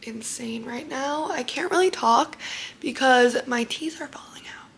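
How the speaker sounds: soft, half-whispered talk through loose temporary teeth; keeping the voice down, mouth sore after surgery; hushed, barely talking. Real, not acted. Teeth falling out, can barely talk.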